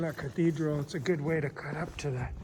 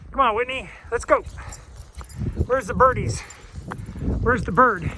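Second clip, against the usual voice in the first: high, loud call to a dog; sing-song dog calling; 4 semitones higher, 5 dB louder than usual. Real, not acted.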